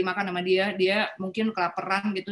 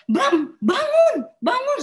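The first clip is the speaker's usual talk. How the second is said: high, loud, drawn-out cry to wake him; desperate plea; 14 semitones higher, 6 dB louder, much slower than usual. Retold or imitated. Wife pleads with her collapsed husband, desperate and loud. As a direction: beg him to wake up, voice straining. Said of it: "Saya juga".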